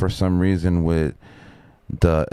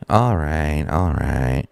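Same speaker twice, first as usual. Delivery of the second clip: lower, placating mumble of surrender; grudging backing down; 4 semitones lower, slower than usual. Retold or imitated. Worker giving in to the yelling crew, low grudging surrender. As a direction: give in, imitating the worker.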